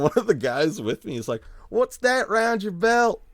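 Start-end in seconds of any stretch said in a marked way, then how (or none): 1.7-3.2 high, slow, louder mock bumpkin; gentleman bumpkin voice. A friend teasing the fan in the bumpkin voice. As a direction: ham up the folksy jab. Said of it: "start talking like this".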